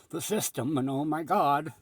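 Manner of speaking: loud, scandalized mock protest; mock outrage. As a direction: act shocked at the heresy. Mock shock at him criticizing the system.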